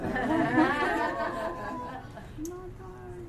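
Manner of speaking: high, trailing laughter; real giggling fit; long laugh. Real, not acted. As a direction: laugh it out at your own mistake. Laughing at her mistake.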